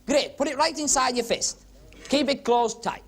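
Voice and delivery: ordinary talk, nothing marked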